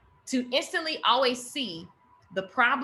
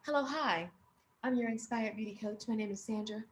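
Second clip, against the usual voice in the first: a touch of soft poise; calm soft voice; 3 semitones lower, 9 dB quieter than usual. Retold or imitated. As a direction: gently introduce yourself. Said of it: "she's a calm person".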